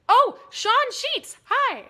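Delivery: high, loud, slow gushing hello; excited greeting. Real, not acted. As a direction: greet him with a big high call. Greeting friend on stream.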